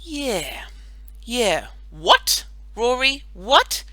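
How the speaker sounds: eager. Acted. Deborah Jo presses eagerly.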